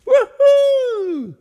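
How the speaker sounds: soaring high, loud whoop; joyful whoop. Acted. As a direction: whoop up from the water. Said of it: "gav till ett indiantjut".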